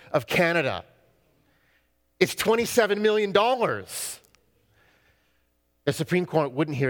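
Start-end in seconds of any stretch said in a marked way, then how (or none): 2.2-4.2 pitched up, falling hard at the end; incredulous protest. Retold or imitated. Ticket buyer pressing his case to court, incredulous. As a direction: plead the huge sum, voice raised high.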